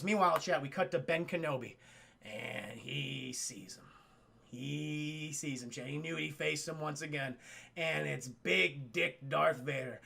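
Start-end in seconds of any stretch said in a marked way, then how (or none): 2.2-10.0 ominous narration. A narrator's reading. Dramatic narration of Vader's entrance.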